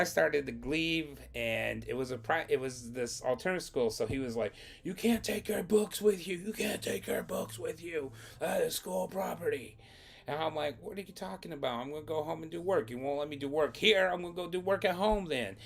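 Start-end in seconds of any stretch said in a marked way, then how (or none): 4.8-9.8 high, insistent mock scolding; scolding principal. Acted principal forbidding taking books. 10.8-15.6 high, fast retold protest; indignant arguing back. Acted student arguing back at principal.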